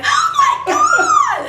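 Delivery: very high, loud, drawn-out squeal; shriek of excitement. Retold or imitated. Hearing the ghost answer her name, long excited squeal. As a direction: squeal like a giddy twenty-two-year-old. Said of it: "I'm like".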